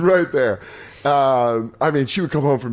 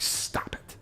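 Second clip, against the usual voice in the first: whispered, half-voiced telling-off; imitated mom's scolding; 5 dB quieter, much slower than usual. Mom reacting to teasing about her neighbor, whispered scolding. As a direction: shush them under your breath like an embarrassed mom. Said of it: "that's the exact energy she hits it with".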